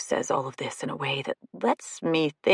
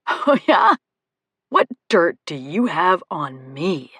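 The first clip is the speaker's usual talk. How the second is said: taunting male character; 5 dB louder than usual. A narrator's reading. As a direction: dare her to spill the dirt.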